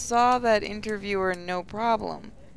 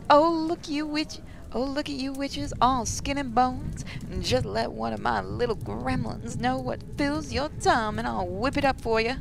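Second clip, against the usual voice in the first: higher, folksy cook's voice; doting catering lady; 4 semitones higher, 3 dB quieter than usual. Acted. Catering lady fussing over skinny witches, doting.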